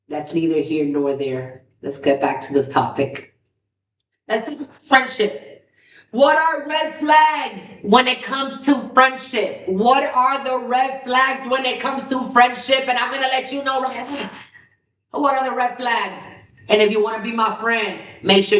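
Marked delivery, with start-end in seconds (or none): 4.3-14.3 vlog intro. Acted. Vlog intro, topic announcement.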